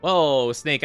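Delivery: usual speaking voice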